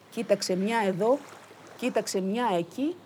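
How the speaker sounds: ordinary delivery, nothing marked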